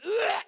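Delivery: a high, loud groan of disgust; loud mock groan at the man carving an apple; loud disgusted ugh. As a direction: recoil with a big mock "ugh".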